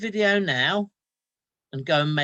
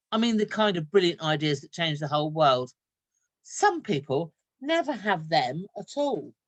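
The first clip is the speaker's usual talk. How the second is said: lively read-aloud storytelling voice; story narration; 5 dB louder than usual. A narrator's reading. Teacher reading a story to her class, lively. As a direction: tell it like a picture book to children.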